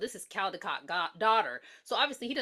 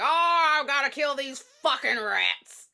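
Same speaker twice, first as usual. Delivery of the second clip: louder, odd character voice; mock villain excuse; 3 semitones higher, 6 dB louder, slower than usual. Newberry excusing himself to the cop. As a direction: sell the creepy excuse. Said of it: "He's like".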